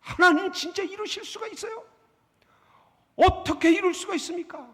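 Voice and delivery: high-pitched outraged protest to God; indignant Jonah. Retold or imitated. Preacher voicing Jonah complaining to God, high indignant cry. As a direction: voice Jonah's angry disbelief, pitched well up. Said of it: "요나의 감정을 얘기하자면".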